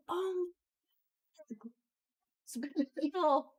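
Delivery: high, slow, breathless awe; awed. Retold or imitated. Amazed friend gushing over a girl swatting a hornet, awestruck. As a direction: gush over it like a miracle. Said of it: "And I was just like".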